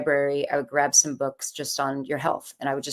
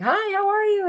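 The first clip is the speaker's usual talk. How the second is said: much higher, chirpy bright greeting; perky shop lady. Retold or imitated. Shop lady greets a panicking customer, perky high voice. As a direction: play the overly cheerful clerk saying hello. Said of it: "the lady was all perky".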